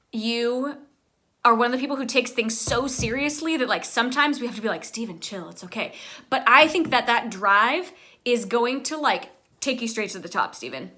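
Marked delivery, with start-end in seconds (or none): 4.8-5.9 mock soothing. What we say to calm him.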